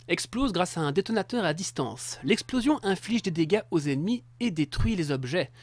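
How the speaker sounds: loud robot voice reading an item description. Pretend. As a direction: announce it like a machine. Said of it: "Je reprends".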